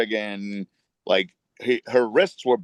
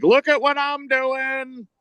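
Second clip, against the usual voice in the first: much higher, slow mocking put-on voice; slower than usual. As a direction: drag it out high like a sarcastic wink to the camera. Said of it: "been like".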